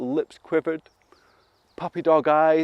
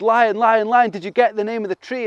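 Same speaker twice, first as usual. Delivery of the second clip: eager asking; 7 semitones higher, 4 dB louder than usual. Acted. Animals eagerly greet the lion.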